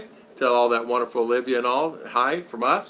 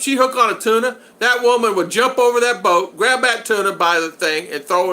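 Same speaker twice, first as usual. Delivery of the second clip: excited bragging; 8 semitones higher, 6 dB louder than usual. Real, not acted. Bragging about a fishing woman.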